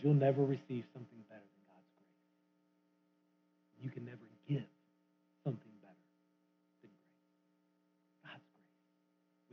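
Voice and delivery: hushed preaching. Real, not acted. Intimate close of the point.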